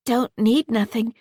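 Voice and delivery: plain reading voice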